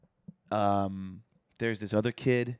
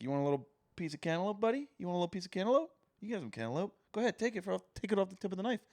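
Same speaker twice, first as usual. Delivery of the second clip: higher, lightly softened coaxing voice; imitated coaxing brother; 6 semitones higher, 4 dB quieter, much faster than usual. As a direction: coax a little kid, pitched up. Acts brother offering Ollie food.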